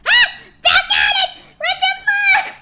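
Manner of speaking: high, loud cry of dismay; exasperated outburst. Real, not acted. Streamer yelping at a viewer's prank, high and loud. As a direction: yelp out in mock despair. Reacting to a redeem.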